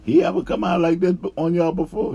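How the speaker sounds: loud, high-pitched disbelief; retold astonishment. New worker asking coworkers about his outburst, incredulous. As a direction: act out an indignant question.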